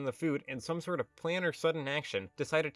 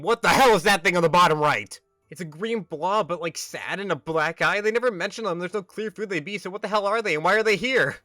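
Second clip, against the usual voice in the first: exasperated yelling; 4 semitones higher, 9 dB louder than usual. Real, not acted. Baffled outburst at strange creature.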